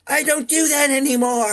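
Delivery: very high, loud mock protest; imitated evil character. Actor stopping his evil laugh, high loud imitated protest. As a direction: cry it out in a high put-on voice. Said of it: "he st-, stops himself".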